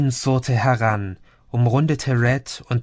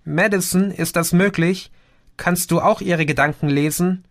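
higher-pitched, mildly desperate questioning; 6 semitones higher than usual. A narrator's reading. Chrissy asks Madison about mind reading, mildly desperate. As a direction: plead for an answer with a touch of despair. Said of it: "fragte Chrissy verzweifelt".

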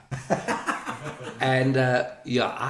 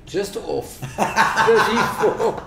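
high, loud, slow and laughing; drawn-out, laughing mock greeting; mock phone answer; 9 semitones higher, 5 dB louder, much slower than usual. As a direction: answer the phone like a joke caller. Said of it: "But I'd say".

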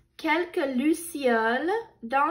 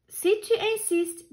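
much higher, gently firm; high sweet ladybug voice; 9 semitones higher than usual. A narrator's reading. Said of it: "dit la coccinelle gentille".

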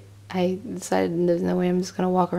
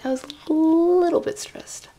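wry understatement; 10 semitones higher than usual. Real, not acted. Stranded traveler admitting her stress, wry high-pitched understatement. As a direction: stretch it upward, making light of stress.

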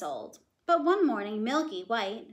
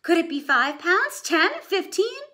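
higher, louder rising guesses; excited mother, higher and louder; mother guessing excitedly; 8 semitones higher, 9 dB louder, slower than usual. A narrator's reading. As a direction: climb with each guess. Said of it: "she cried".

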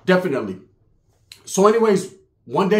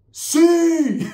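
wife's reply; 11 semitones higher, 3 dB louder than usual. Retold or imitated. Wife answers.

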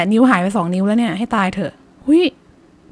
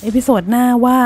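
ordinary talk, nothing marked

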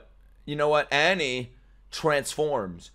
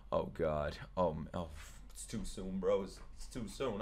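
much softer, lower mumbling; dismayed groan; 8 semitones lower, 14 dB quieter than usual. Real, not acted. Streamer groaning at a dreaded matchup, much softer. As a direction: sink in defeat, under your breath.

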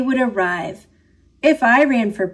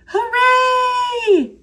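cheering class; 13 semitones higher than usual. A narrator's reading. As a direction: cheer like a whole class of excited kids. Class cheers a candy promise.

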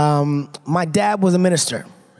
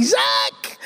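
high, strained mock squeal; excited fan; 8 semitones higher than usual. Kid running up to hug the actor, mock excited squeal. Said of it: "you run up, hug me".

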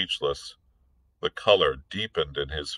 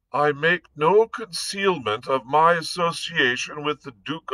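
weak voice; 7 semitones higher than usual. A narrator's reading. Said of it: "in a weak voice".

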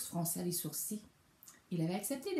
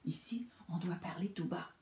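breathy whisper, softer; insistent whisper; 4 dB quieter than usual. A narrator's reading. Mouse reminding the bear to keep quiet, whispering. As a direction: hush him with a real whisper. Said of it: "insista la souris".

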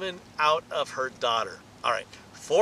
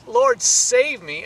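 slow, loud, higher plea; crying out; 5 semitones higher, 6 dB louder, much slower than usual. Acted. Peter sinking in the water, crying out loud. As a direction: cry out as you sink. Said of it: "I'm in deep water here".

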